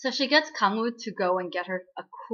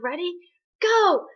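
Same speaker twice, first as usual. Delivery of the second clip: high questioning ready, then a loud shouted go; race-start call; 9 semitones higher, 6 dB louder than usual. Retold or imitated. Starting the ghost's race, called out.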